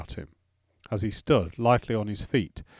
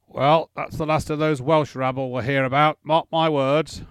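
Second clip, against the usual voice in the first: put-on drunken lord voice; 4 semitones higher, 6 dB louder than usual. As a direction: boom it out like a drunk host. Drunk lord Wallace boasting.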